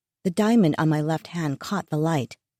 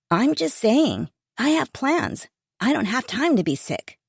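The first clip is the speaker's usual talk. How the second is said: put-on teen son voice; 6 semitones higher than usual.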